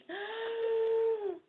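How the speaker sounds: loud, high gasp of disbelief; sharp high gasp, half a shout; high shocked gasp. Retold or imitated. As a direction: gasp like you just saw a miracle. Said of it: "And I was like".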